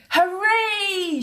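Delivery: high excited cheer; a boy cheering the passing Queen. A narrator's reading. Sam cheering as the golden coach passes. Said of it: "shouted Sam".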